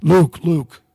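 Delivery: high, loud call across the car; urgent retold call of a name. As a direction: call his name to get his attention. Man calls out in dark train.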